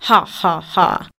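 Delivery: slow, spelt-out "ha, ha, ha"; lightly acted, deliberate low laugh; mock lion laugh. A narrator's reading. Lion laughs at the little mouse, mock scornful laugh. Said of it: "The lion laughed at the little mouse".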